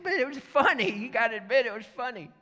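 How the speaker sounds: high, loud pleading excuse; acted-out defensive plea; whiny protest. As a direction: protest like scolded underlings. Acted protest from laughing Klansmen.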